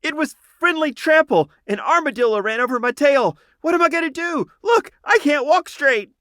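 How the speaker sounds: very high, loud squeaky panic; a flustered little creature wailing about its tail; frantic raccoon shouting. A narrator's reading. Injured raccoon circling the hospital tent, frantic high shouting.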